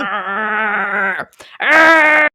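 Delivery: 1 dog growl. Pretend.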